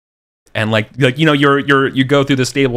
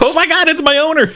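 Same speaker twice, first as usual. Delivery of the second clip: very high, laughing horse voice; excited horse; 5 dB louder than usual. Retold or imitated. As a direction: squeal it like a horse thrilled to see its owner.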